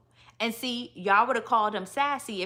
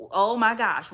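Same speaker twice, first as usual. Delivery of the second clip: normal talking voice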